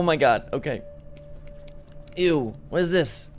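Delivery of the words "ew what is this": ordinary talk, nothing marked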